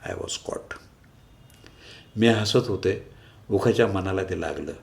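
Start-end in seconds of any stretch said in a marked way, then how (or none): 0.1-0.7 voiceless whispered confession; a whispered English aside to the listener; hushed aside. Acted. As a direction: whisper it as if caught out. Narrator's sudden guilty realisation.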